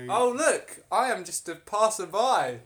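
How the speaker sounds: high, loud put-on voice; innocent passerby. Blacksmith pretending to be a random passerby, loud and hammed-up. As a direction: announce yourself with hammed-up innocence.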